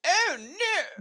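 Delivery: high-pitched, prim put-on voice; very proper uncle. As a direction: squeal it out very properly. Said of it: "Very proper".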